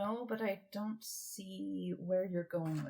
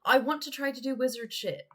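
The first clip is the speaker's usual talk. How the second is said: raised, insistent; loud insistent repeat; 6 semitones higher, 8 dB louder than usual. Real, not acted. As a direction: say it louder so they hear. Repeats louder to be heard.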